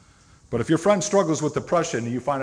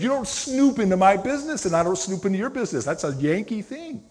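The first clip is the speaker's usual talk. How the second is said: much higher, incredulous mock voice; Midwest friends reacting; 9 semitones higher, 6 dB louder than usual. Friends in the Midwest laughing at the fence saying, high incredulous mock voice. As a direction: play the baffled Midwesterners arguing about boundaries. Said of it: "They went, they just laughed".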